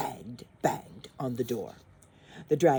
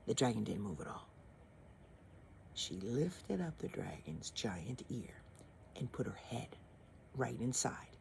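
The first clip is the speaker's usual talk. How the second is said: whispered, softer narration; hushed whisper; 3 semitones lower, 12 dB quieter than usual. A narrator's reading. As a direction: tiptoe the words, whispering. Narrator hushes while dragon sleeps.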